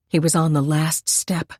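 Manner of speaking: ordinary delivery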